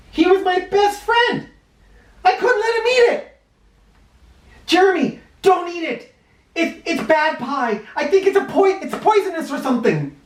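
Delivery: put-on boy panics to stop his friend eating; panicked. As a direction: rush to stop him in alarm. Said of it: "It was at this point that I panicked".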